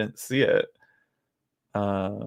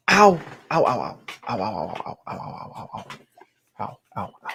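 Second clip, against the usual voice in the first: in pain; 2 semitones lower than usual. Real, not acted. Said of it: "That hurt".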